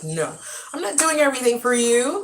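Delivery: exasperated parent laying down the law; firm refusal. Retold or imitated. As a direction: put your foot down, retelling it. Telling off her four-year-old, retold.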